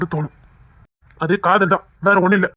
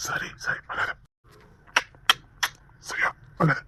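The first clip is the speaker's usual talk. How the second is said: soft soothing; 4 semitones lower, 10 dB quieter, slower than usual. Acted. Husband comforts crying wife.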